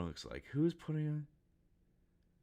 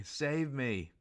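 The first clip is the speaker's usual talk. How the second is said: mildly desperate, pitched-up plea; 7 semitones higher than usual. Acted. Sanji pleads to be saved from being killed, desperate. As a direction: lift it into a mock cry for rescue. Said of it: "So he's very desperate right now".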